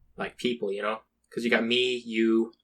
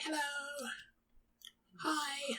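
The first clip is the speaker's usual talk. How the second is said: awkward high hello; 13 semitones higher, 18 dB quieter than usual. Retold or imitated. Awkward greeting instead of confronting.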